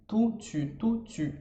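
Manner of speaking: tu then tout, the tongue rising then falling; tu versus tout. Shown as a demonstration. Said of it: "a confusão que você pode fazer ao falar tu ou tu".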